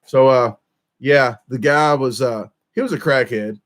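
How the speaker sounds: ordinary talk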